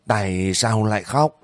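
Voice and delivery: plain reading voice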